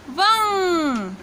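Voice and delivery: वंग stretched very slowly, nasal ending held; drawn-out syllable. Shown as a demonstration. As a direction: sound out वंग slowly for the class. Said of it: "तो उच्चारण हो जाएगा".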